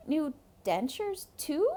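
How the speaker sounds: put-on a kid's startled question; boy Rico, alarmed.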